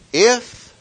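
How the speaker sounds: "If" said high and loud, held before the rest; stress on if. Shown as a demonstration. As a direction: hit "If" hard, then pause. Preacher stressing the condition.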